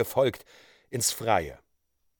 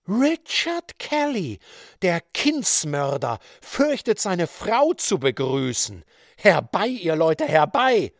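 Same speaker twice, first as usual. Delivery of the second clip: wild accusing woman; 5 semitones higher, 5 dB louder, slower than usual. A narrator's reading. Wife denounces husband before crowd.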